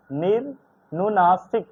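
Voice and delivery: निर्नुनासिक spelled out syllable by syllable, slowly; teacher finally getting निर्नुनासिक right, slowly; careful slow reading. Shown as a demonstration. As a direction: sound out निर्नुनासिक slowly with a rise at the end. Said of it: "इसको पढ़ते हैं".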